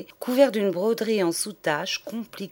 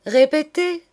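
high, loud snapped order; loud impatient teacher's command; sharp command; 9 semitones higher, 7 dB louder than usual. Acted. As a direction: bark it at the mumbling boy. Teacher demands repeat.

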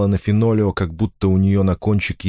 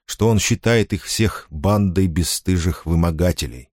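ordinary delivery

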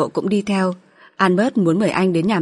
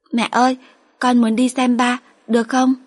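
put-on higher childlike voice, pleading; young daughter; 6 semitones higher than usual. Daughter at bedtime asking mother to visit her father, higher childlike voice.

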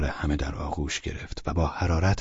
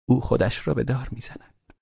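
mumbled as if delirious; 6 semitones higher than usual. Acted.

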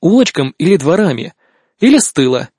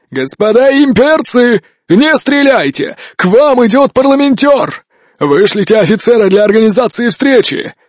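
loudspeaker voice; 8 semitones higher than usual. Acted. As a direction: announce it through a crackling megaphone. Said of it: "голос из громкоговорителя". (голос из громкоговорителя)

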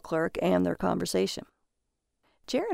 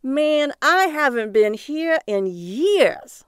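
slow, higher and louder with delight; a cabbie thrilled to be back in Vandy after years; excited; 7 semitones higher, 5 dB louder, much slower than usual. Acted. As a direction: savor the homecoming, drawn out. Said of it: "The cabbie seemed to get excited".

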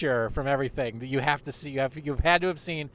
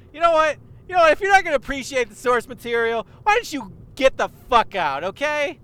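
higher, louder hammed-up telling-off; mock angry; 10 semitones higher, 6 dB louder, faster than usual. Kid throwing rude fans out, higher mock rant. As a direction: throw them out with fake fury, pitched up.

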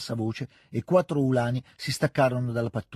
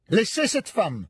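slow, high, furious yell in French; furious shout; 8 semitones higher, slower than usual. A narrator's reading. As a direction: roar it at the soldier to let her go. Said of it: "urlò con voce furibonda Pierre".

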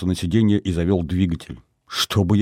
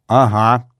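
louder, testy retort; hot-headed snort; 3 semitones higher, 6 dB louder than usual. A narrator's reading. Rita scoffs at his reassurance, heatedly. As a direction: throw it back with a touch of temper. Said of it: "запальчиво фыркнула Рита".

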